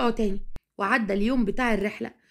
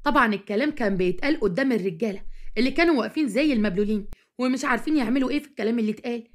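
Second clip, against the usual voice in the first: ordinary delivery, nothing marked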